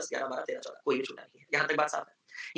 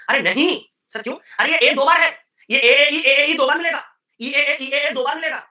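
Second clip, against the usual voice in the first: loud, high exasperated shout; shouting correction; 7 semitones higher, 9 dB louder than usual. Real, not acted. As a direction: yell the correction at the student. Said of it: "समझ गया, चिल्लाओ ना". (छात्र पर ज़ोर से चिल्लाते हुए सुधार)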